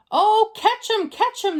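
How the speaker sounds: high, loud cry of catch him; excited shout. A narrator's reading. Firemen spot the monkey and shout to catch him, high excited cry. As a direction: chase him down, urgent.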